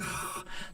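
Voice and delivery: soft acted sigh; retold sigh. Comic recalling hecklers chatting over his set, sighing. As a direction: let out a quiet, breathy sigh. Acting out his onstage reaction.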